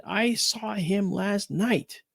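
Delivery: mother's voice. Retold or imitated. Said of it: "my mother said".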